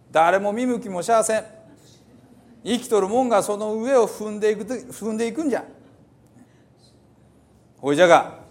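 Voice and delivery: put-on old Hiroshima dialect. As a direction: declaim the psalm like an old Hiroshima elder. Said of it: "ちょっと古い広島弁ですね". (古い広島弁で朗読)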